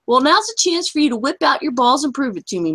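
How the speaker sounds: ordinary talk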